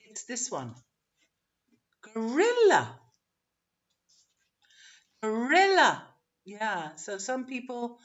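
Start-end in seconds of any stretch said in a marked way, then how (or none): 2.1-6.1 "Gorilla" with a high, loud "RIL"; weak "go", punch "rilla"; second-syllable stress. Shown as a demonstration. Teacher modelling the English word, middle syllable stressed. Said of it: "So it's the second syllable".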